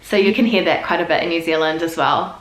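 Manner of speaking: ordinary delivery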